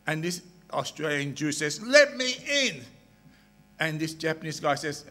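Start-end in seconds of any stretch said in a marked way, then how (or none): 1.8-2.8 demanding plea. Acted. Traveller repeats demand for entry.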